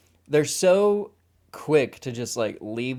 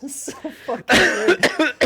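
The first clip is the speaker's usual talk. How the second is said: laughing; 11 dB louder, slower than usual. Real, not acted. Laughing at the scene.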